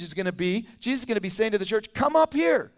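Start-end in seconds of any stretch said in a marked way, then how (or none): 1.9-2.7 high, raised summons; big ringing call; commanding shout. Acted. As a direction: call the whole church up to you. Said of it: "I think that shout, the important message".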